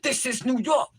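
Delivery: hammed-up rude address to a man; mock tough talk. Woman showing men how not to address them, brash mock tough talk. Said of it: "You don't want me to be like".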